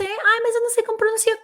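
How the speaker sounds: high, quick mock whine of an excuse. Irritated teacher mocks students' excuses, high and fast. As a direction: parrot the excuse in a high, silly voice. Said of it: "Eu tô dando as ferramentas pra você".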